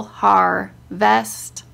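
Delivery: har... vest, slow with a gap between syllables; broken into syllables. Shown as a demonstration. Teaching her child spelling, word split by syllable. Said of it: "break it down by syllable".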